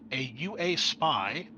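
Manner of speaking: normal talking voice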